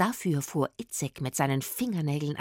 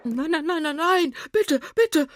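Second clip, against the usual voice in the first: high, loud panicked pleading; frantic begging cry; calling out in fear; 9 semitones higher, 6 dB louder than usual. A narrator's reading. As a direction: beg for mercy in a panic. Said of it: "rief das Gespenst".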